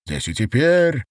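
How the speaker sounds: restrained yell, voice about to break; cracking shout. A narrator's reading. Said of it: "закричал Гитлер срывающимся голосом".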